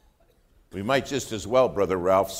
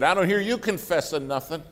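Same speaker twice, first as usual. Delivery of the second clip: higher, mild mock yell; retold yell; 7 semitones higher than usual. Lawyer to preacher during confession, half-yelled. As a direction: call it out like an accusing yell. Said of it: "he yelled at me".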